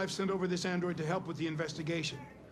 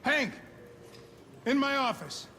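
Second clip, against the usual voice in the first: calling out; 5 semitones higher than usual. Acted. Captain calls Hank in.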